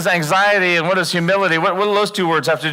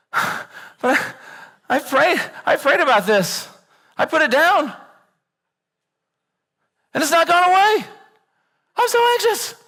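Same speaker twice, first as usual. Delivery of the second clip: much higher, rising into a mock whine; higher and higher mock panic; panicked whiny pray-er; 12 semitones higher than usual. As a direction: play the frantic anxious believer. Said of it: "we're like".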